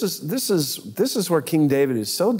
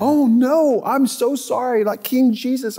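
much higher, louder mock panic; panicked apology; 10 semitones higher, 4 dB louder than usual. Courtiers realizing the prophet is real, high panicked apology. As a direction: blurt it out high and shaken.

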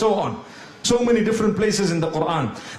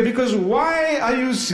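ordinary talk, nothing marked

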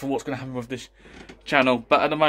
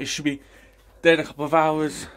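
usual speaking voice

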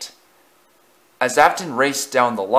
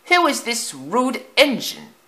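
much higher, fussy coach voice; an affronted old carriage asks; indignant old coach; 12 semitones higher than usual. Acted. Lead coach asks about the rude engine, high and prim. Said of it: "asked the lead coach".

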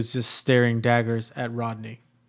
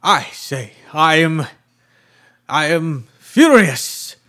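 booming angry god voice; furious deity; 5 semitones higher, 9 dB louder than usual. Acted. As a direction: roar it as an enraged deity. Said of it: "pretend you're that deity and just be really pissed off and yell at him".

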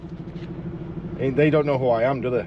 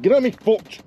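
exasperated exclaim; 7 semitones higher than usual. Real, not acted. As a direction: call it out annoyed. Repeats line differently.

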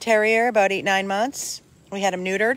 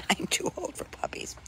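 whispered through a laugh, much softer; a tired aside, laughing under breath; 9 semitones higher, 12 dB quieter than usual. Real, not acted.

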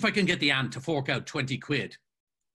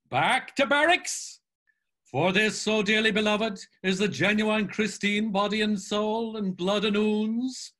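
much higher and louder, a mock priest's sermon; mocking Buck Mulligan; 8 semitones higher, 6 dB louder than usual. A narrator's reading. Buck Mulligan voiced in reading.

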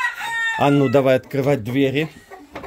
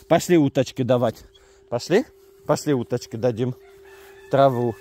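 ordinary delivery

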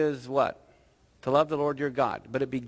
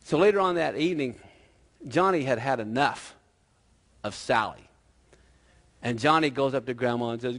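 normal talking voice

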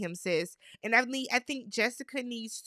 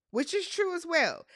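much higher-pitched restatement; emphatic repeat; 8 semitones higher than usual. Real, not acted. Host repeating her point to back Johnny, emphatic and high. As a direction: say it over, pitched right up for emphasis.

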